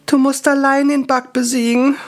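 high, sobbing dying elf; a touch of sobbing, high elf. Acted. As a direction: sob it as you fade. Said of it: "schluchzte Ankör".

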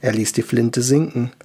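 plain reading voice

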